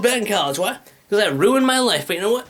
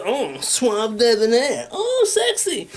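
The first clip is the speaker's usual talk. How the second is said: drawn-out admiring coo, leaping high at the end; playful, exaggerated ooh-la-la; mock swooning; 4 semitones higher, much slower than usual. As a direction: ham up being smitten by a photo. Mock admiration of own profile picture.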